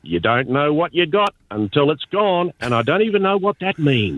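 high, lightly broad country twang; Aussie farmer voice. Retold or imitated. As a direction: keep the outback farmer going. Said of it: "your typical Aussie voice".

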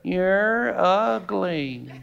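slow, flat parrot squawk of "You're ugly". Acted.

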